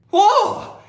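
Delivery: high, loud amazed whoa; overwhelmed exclamation; cartoonish surprised gasp of a word. Reacts to lots of info.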